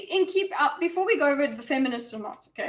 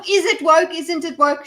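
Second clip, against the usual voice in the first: mocking voice; 5 semitones higher, 6 dB louder than usual. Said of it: "this is not".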